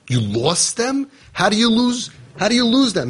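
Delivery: high, disbelieving "how do you lose them"; incredulous. Retold or imitated. Retelling the volunteer's call, asking in high disbelief. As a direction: pitch it up in shocked disbelief. Incredulous retort to lost kids.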